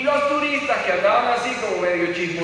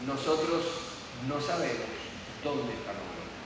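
dramatic hush; 8 semitones lower, 11 dB quieter than usual. Acted. Remate dramático del seminario.

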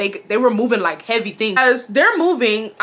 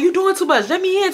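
Wesley pleading; 9 semitones higher than usual. Retold or imitated. Wesley voiced again.

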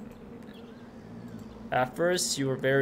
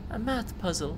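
put-on higher, light girl's voice for Lena; 6 semitones higher than usual. Player voicing Lena, asking about her math puzzle, in a higher girl voice. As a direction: read it as the young girl, pitched up. Lena's line, then man repeats.

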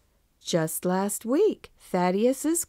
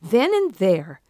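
ordinary delivery, nothing marked

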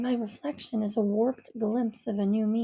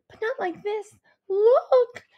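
higher, sobbing howl of despair; howling cry; 10 semitones higher, 6 dB louder, slower than usual. A narrator's reading. Daughter howling at her mother over icy tears, crying. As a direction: wail it out through tears. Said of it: "I howl as the tears".

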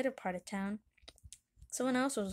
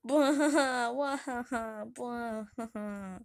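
hammed-up boo-hoo sobbing; cartoon crying fit; mock bawling; slower than usual. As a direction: burst out wailing, then let it fade. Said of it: "Chief's really crying".

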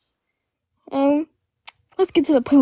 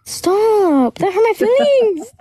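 drawn-out, higher-pitched whine; whiny hurt protest; 5 semitones higher, much slower than usual. Real, not acted. Guest tells host the remark hurt, whiny protest. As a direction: wail it in wounded protest. Playfully hurt by host's tease.

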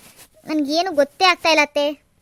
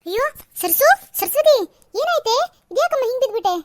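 put-on much higher, shocked cry over the fallen girl; alarmed aunt; 8 semitones higher than usual. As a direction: rush to her in alarm. Aunt finds the girl collapsed. (ಗಾಬರಿಯಿಂದ ಕೂಗುವ ಅತ್ತೆ)

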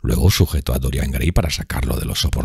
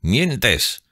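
exclaimed; 9 semitones higher than usual. A narrator's reading. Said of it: "exclamó el marinero".

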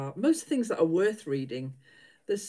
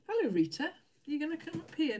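higher sing-song call to the dog; coaxing pet voice; 9 semitones higher than usual. Real, not acted. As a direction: coax the dog up, pitched up and sweet.

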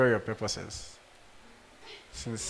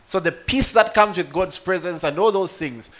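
usual speaking voice

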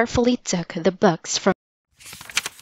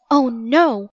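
mildly alarmed, raised pitch; a touch of dismay, pitched up; dismayed cry; 4 semitones higher, 3 dB louder than usual. A narrator's reading. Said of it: "cried Jack".

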